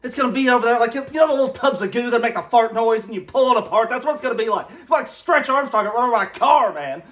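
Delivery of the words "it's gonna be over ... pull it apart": normal talking voice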